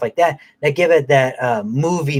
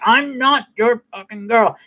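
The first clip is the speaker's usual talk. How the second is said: put-on high, defiant girl's voice, defiant and pitched up; 9 semitones higher than usual. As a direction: throw it back at him like a cornered teenager. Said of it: "She's like".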